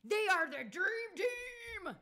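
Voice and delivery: high, slow and loud; hammed-up American hype line; big American shout. Shown as a demonstration. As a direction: belt it like an announcer. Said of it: "in my American accent".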